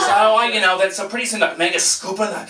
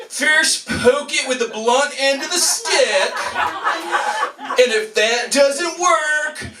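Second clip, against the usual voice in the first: higher, slow laid-back captain; casual captain; 6 semitones higher, much slower than usual. Retold or imitated. Captain instructing the divers on sharks, higher and slow. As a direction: give deadly advice very casually.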